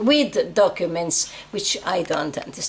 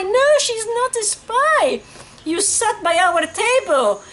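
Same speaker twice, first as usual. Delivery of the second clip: much higher wailing cry of a beaten mother; 13 semitones higher, 3 dB louder than usual. Retold or imitated. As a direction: call out to your daughter in anguish, pitched high. Mother defends daughter to guards.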